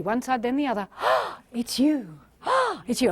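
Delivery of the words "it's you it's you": delighted gasp. Shown as a demonstration. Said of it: "on the count of three".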